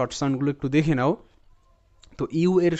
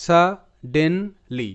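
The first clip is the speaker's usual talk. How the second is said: suddenly drawn out syllable by syllable; teacher spelling out suddenly slowly; slow syllable breakdown; much slower than usual. Shown as a demonstration. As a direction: stretch suddenly slowly, part by part. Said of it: "এটার উচ্চারণটা এভাবে আমি করব".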